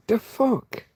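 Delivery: high, startled "the fuck?"; baffled outburst. Real, not acted. Missing the mosquito, high startled disbelief. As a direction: jolt up in disbelief at the miss.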